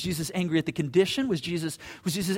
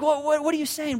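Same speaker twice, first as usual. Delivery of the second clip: put-on baffled kid; 8 semitones higher, 5 dB louder than usual. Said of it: "they're like".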